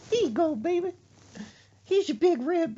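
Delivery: high-pitched, falsely obliging mother; mama voice. Retold or imitated. Mother returning sneezed-in soda, high mock-obliging voice. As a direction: hand it back in a high mama voice. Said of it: "It says".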